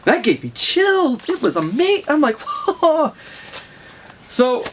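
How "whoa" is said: high, drawn-out whoa of amazement; awed whoa. Retold or imitated. A reader given chills by the panel, awed. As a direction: breathe out pure astonishment, pitched up.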